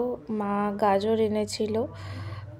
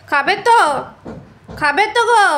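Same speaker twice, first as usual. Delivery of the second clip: high, loud sing-song coaxing; coaxing pet talk; 9 semitones higher, 9 dB louder than usual. Real, not acted. Owner coaxing the pet to take its food, high sing-song. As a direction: sweet-talk the pet into eating.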